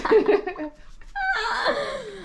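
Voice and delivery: high, squealing burst of laughter; shrieking laugh. Real, not acted. Bursts out at the dog. (자지러지는 높은 웃음소리)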